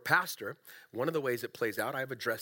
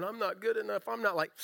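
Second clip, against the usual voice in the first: higher-pitched, lightly whiny self-doubt; a touch of a whiny inner voice; 6 semitones higher than usual. Acted. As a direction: put on a mild whine. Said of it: "this is my whiny voice".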